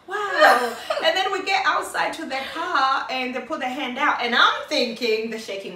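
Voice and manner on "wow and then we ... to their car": laughing. Real, not acted. Laughing over tipping surprise.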